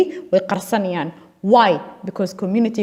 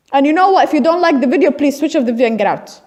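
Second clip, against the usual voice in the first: defiant outburst; 5 semitones higher, 4 dB louder than usual. Real, not acted. Defiant challenge to viewers.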